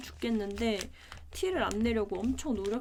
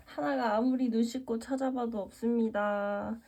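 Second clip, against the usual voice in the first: usual speaking voice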